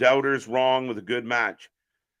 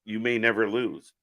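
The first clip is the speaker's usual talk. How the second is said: usual speaking voice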